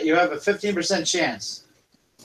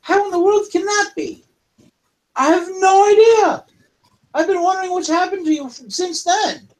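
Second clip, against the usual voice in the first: high worried kobold; 11 semitones higher than usual. Acted. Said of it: "reunion dialogue continues".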